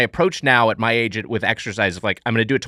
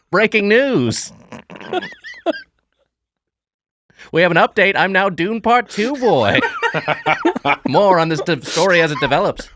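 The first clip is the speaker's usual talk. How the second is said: newsreel announcer; 6 semitones higher than usual. Acted. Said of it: "You're a newsreel guy".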